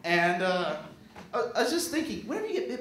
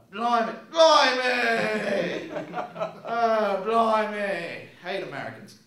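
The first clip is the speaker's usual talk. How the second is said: mock drunk English accent; 3 semitones higher, 4 dB louder, slower than usual. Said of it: "I was in England".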